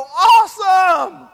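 loud, high, drawn-out "Awesome!"; excited cheer. Pretend. Preacher acts out the congregation's reaction to good news, excited cheer. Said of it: "you're gonna go".

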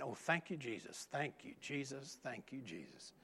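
muttered thanks under the breath; under his breath. Retold or imitated. Preacher retelling selling the dog, under his breath. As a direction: mutter relieved thanks to himself. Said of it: "under my breath saying".

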